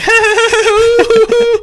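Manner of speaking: very high, sustained mock squeal; high-pitched impression of someone's giddy noise; imitated squeal. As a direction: let out a long, piping squeal, imitating a friend. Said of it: "you were just like".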